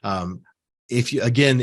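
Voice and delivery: ordinary talk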